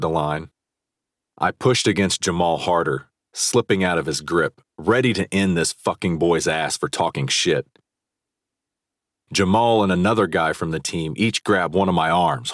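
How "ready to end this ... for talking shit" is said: ordinary delivery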